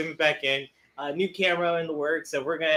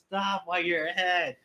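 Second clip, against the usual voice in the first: ordinary talk, nothing marked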